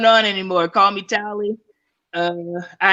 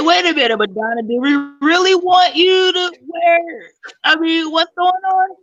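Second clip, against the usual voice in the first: mock outraged fan; 7 semitones higher than usual. Retold reaction to [name]'s outfit.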